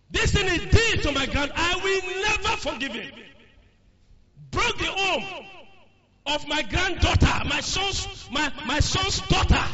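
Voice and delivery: put-on high, loud, bitter old man who will not forgive; grudging grandfather. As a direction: cry out the family grudge as the wronged grandfather. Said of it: "it was a family grudge".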